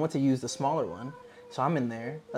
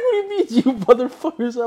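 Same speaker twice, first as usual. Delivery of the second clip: scared; 8 semitones higher, 8 dB louder than usual. Retold or imitated. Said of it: "I was so scared".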